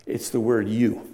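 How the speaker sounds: ordinary talk, nothing marked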